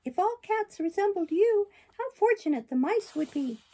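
high, loud puppet voice, not a whisper; a touched boy praising the cat. Acted. Pinocchio speaks to the cat in a high, loud puppet voice. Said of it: "he whispered into her".

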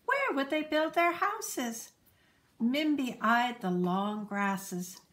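ordinary delivery

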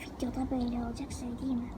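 hushed aside; whispering. Real, not acted. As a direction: whisper it off mic. Aside to cousin off-camera.

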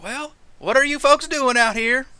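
put-on folksy lizard-folk country accent; country rancher accent. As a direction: retort in a high rancher accent. Lizard rancher questions the strangers.

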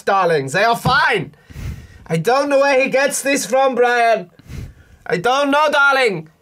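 high, loud, fussing posh mother calling everyone darling; posh tipsy mum. Pretend. Mum scolding her son over lights, loud posh fuss. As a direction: play the frazzled mum begging for another drink. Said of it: "Let's role play that situation".

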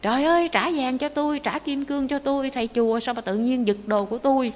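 high-pitched, pleading outcry; frantic cry. Acted. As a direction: act out a robbed man crying after the thief. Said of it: "ông la lên". (la lên hốt hoảng)

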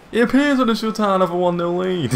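loud, high, sustained announcement; excited cry held high; elated crowing. Real, not acted. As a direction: proclaim the lead in triumph. Crowing over the goal.

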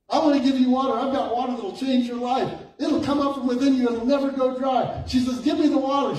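high, driving pulpit delivery; emphatic preaching. Real, not acted. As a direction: proclaim the promise with rising energy. Preaches the retold promise.

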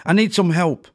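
high, loud angry demand. A narrator's reading. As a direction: push the word need, angry and loud. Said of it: "replied in an angry tone".

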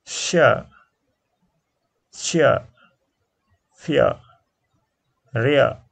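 share, fear and rear each said loud and separate; diphthong drill. Shown as a demonstration. Teacher drills diphthong words for students, loud. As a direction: drill each word with a clear glide. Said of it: "pronounce it diphthong A R".

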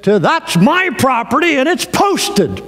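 high, slow, insistent shout; angry yell. Retold or imitated. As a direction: lay down the law to the trespasser. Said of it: "And he yelled again".

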